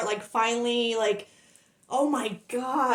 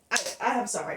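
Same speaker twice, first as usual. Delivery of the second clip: usual speaking voice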